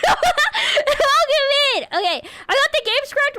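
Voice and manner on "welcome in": high, slow laughing cheer; laughing welcome. Real, not acted.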